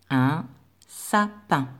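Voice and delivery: usual speaking voice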